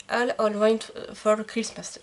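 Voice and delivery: slow English title in a self-conscious French-English accent. Real, not acted. Vlogger reading an English category title aloud to viewers, in awkward French-accented English. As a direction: read the English title carefully with a shaky English accent. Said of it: "Excusez-moi mon accent anglais".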